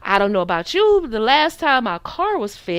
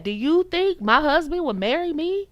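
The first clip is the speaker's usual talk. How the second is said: ordinary talk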